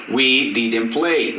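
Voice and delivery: "I didn't work" said very slowly, each word apart; slow model sentence. Shown as a demonstration. Teacher models "I didn't work" slowly for students. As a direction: spell it out slowly for the class. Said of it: "el verbo va a ir en presente".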